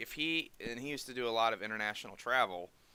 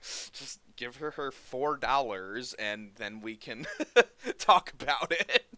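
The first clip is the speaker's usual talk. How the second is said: slow pleading; 2 semitones higher, 6 dB louder, slower than usual. Retold or imitated. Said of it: "And I was like".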